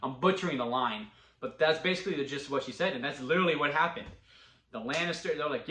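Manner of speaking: ordinary talk, nothing marked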